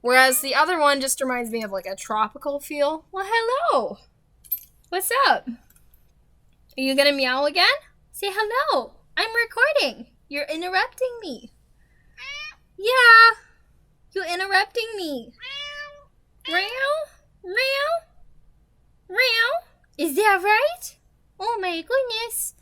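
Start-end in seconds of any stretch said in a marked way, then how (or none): 3.1-17.5 high sing-song voice for the cat; pet talk. Real, not acted. Talking to her cat during the voiceover, high baby-talk voice. 19.1-22.5 high sing-song voice for the cat; pet talk. Real, not acted. Talking to her cat during the voiceover, high baby-talk voice. Copying the cat's meow back.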